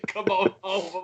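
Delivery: mock coaxing. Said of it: "I absolutely love the expression".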